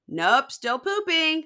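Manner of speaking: high, loud, drawn-out mock reply; singsong call. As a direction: call it through the door, stretched and high. Acting out reply through bathroom door.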